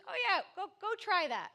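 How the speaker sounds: high teasing sister voice; mocking sister. Sister mocking his beach plan, high teasing voice. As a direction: tease him like a doubting big sister. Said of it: "She goes".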